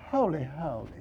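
soft, falling "Holy, holy" said dully; flat, hushed holy. Shown as a demonstration. Preacher showing how the angels did not say it, hushed. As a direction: say "Holy, holy" quietly, without fire. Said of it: "They didn't say".

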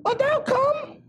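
high, stretched pulpit cry; full-force preaching. Acted. Preacher voicing devils who question Jesus, full-force preaching. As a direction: call out each phrase high and separate.

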